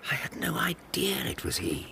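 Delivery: hushed aside, barely voiced; whisper. Real, not acted. Watson whispers to the others about Holmes's disguise. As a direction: whisper it so no one overhears.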